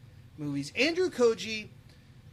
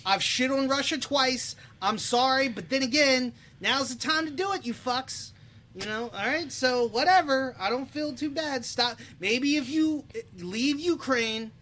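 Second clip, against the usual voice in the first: half-joking tirade, higher and louder; mock rant; 7 semitones higher, 5 dB louder than usual. Podcaster jokingly scolding Russia over his casting, mock-angry rant. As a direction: tell off Russia with playful heat.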